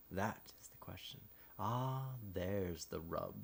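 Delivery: a dark, hushed whisper for the death lines. Shown as a demonstration. Narrator demoing the dying side of Hamlet, whispered and slow. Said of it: "it would be a deeper voice, just slightly".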